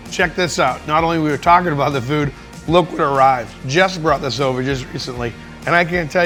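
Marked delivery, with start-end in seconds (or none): none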